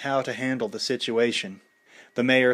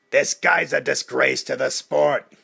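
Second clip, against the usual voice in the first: disgusted; 2 semitones higher, 5 dB louder than usual. A narrator's reading. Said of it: "Mickey said, disgusted".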